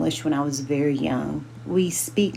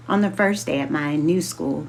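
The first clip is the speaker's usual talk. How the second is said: ordinary delivery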